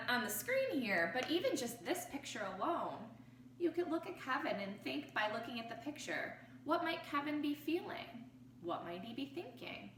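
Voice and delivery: plain reading voice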